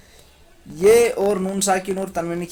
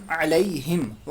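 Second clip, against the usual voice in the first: علیہم blended slowly, syllables held; slow alaihim; much slower than usual. Shown as a demonstration. Teacher joins the syllables of علیہم slowly. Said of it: "علی، ہا میم زیر ہم".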